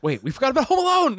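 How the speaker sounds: surprised. Real, not acted. Sudden realization.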